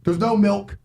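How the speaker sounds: ordinary talk, nothing marked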